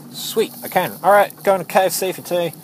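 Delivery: high, upbeat little cheer; pleased. Real, not acted. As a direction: pitch jumps up in happy relief.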